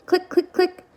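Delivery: high clicking noises; clicking sound. Acted. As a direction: click it out like a button. Button clicking sound effect.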